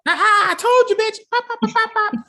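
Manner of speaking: very high, loud mock triumph; Señor Cruz gloating. Host as man leaping from wheelchair, loud high gloating. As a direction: crow it in a high, hammed-up voice.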